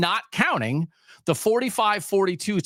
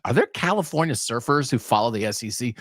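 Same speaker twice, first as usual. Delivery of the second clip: ordinary talk, nothing marked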